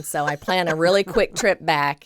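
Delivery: ordinary delivery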